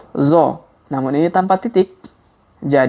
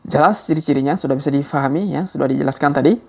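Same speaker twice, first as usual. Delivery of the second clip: ordinary delivery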